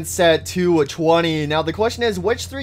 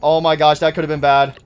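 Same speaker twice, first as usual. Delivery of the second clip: usual speaking voice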